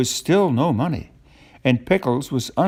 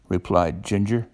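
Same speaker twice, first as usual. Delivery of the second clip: ordinary delivery, nothing marked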